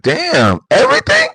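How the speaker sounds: high-pitched squeak on everything; mock disbelief. People reacting to classified secrets, with a high mock squeak of disbelief. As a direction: act stunned in a squeaky high voice. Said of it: "They be like".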